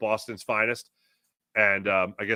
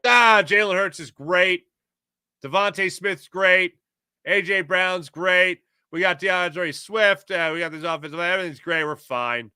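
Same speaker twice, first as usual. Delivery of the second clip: breezy, higher-pitched boasting, names drawn out; mock overconfidence; 6 semitones higher, 4 dB louder than usual. Host mimicking fans blind to last year's problems, mock confident. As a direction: rattle off the stars in mock complacency.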